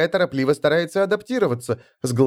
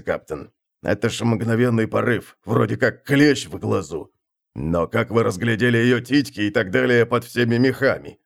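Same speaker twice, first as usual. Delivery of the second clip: gruff sailor voice; 6 semitones lower than usual. Acted. First mate voiced by narrator.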